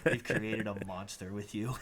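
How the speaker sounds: breaks into laughter mid-quote; laughing through words. Real, not acted. Laughing as he retells Al calling him a monster. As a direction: laugh through the line, slowing down.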